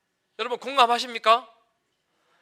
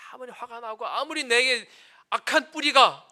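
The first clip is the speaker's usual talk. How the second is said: ordinary talk, nothing marked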